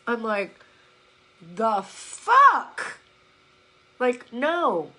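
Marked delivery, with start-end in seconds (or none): 1.5-3.0 incredulous outburst. Retold or imitated. Telling listeners how the book struck her, incredulous outburst. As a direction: blurt it out in loud disbelief. Acted reaction to hated book.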